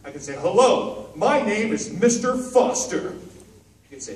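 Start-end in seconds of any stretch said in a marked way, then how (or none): 0.3-3.1 louder, higher, slow and eager; a loud cheerful hello; bright eager greeting. Shown as a demonstration. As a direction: introduce yourself with big energy. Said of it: "lots of ways to say things".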